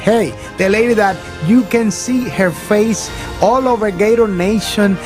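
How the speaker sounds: normal talking voice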